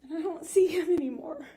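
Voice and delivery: high, cracking voice between laughs and tears; fighting back tears while telling it; tearful laughing cry. Real, not acted. Woman recounting her attacker's attack, tearful and shaking.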